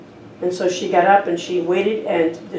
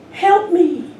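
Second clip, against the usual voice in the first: echo; 10 semitones higher, 3 dB louder than usual. Retold or imitated. The canyon echo answering the boy, a lightly voiced echo. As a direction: voice the canyon echo throwing it back. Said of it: "a voice coming back".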